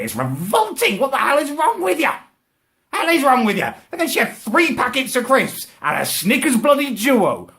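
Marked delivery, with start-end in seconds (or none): 1.0-3.7 high-pitched incredulous rant; exasperated outrage. Retold or imitated. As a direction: demand an explanation in rising disbelief. Exasperated rant at the sandwich eater.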